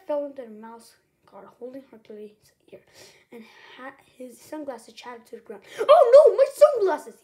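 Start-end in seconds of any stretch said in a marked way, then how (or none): 5.8-7.2 loud, high-pitched yell of dismay. A narrator's reading. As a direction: cry out in alarm over something broken. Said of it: "he yelled".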